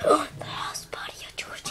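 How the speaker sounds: fast breathless whisper. Pretend. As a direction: whisper it urgently. Dramatic hushed narration.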